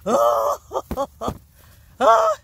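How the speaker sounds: sudden high, loud gasping oh; surprised shriek. Real, not acted. Gardener spotting a baby potato, surprised high shriek. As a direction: jump at the sight.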